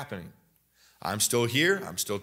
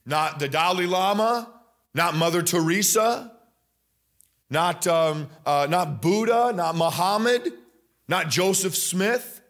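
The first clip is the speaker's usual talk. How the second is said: higher, rising fervour through the list of names; preaching at full force; 5 semitones higher than usual. Acted. Preacher pressing the congregation that nobody else saves, full force. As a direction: preach it, building to the cry of nobody. Builds a fiery roll call.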